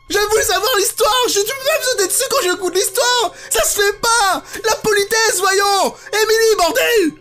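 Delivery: high-pitched exasperated scolding; indignant scolding. Pretend. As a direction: scold her like an offended prude. Scolding game character Emily. (indignation outrée)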